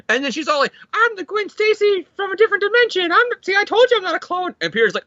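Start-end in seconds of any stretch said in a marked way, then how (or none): 0.9-4.6 high-pitched mock girl voice; excited Gwen voice. Gwen insisting to Peter she's real, high excited voice. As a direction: rush out an insistent announcement way up high. Gwen acted out announcing herself.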